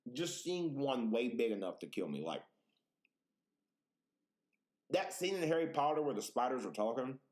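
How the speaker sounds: normal talking voice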